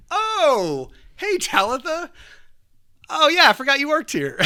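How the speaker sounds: very high, loud, badly faked surprise; hammed-up "fancy meeting you" greeting; feigned surprise. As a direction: pretend you didn't expect them. Acting surprised to bump into her.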